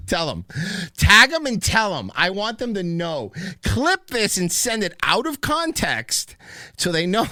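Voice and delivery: high, loud mock challenge; playfully riled-up dare; mock defiant rant. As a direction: egg the listeners on in a hammed-up rant. Mock-daring listeners to stir up feud.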